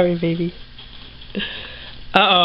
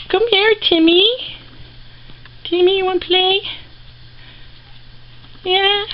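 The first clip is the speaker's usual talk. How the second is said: much higher sing-song voice to the rat; 8 semitones higher than usual. Real, not acted. Baby talk to pet rat.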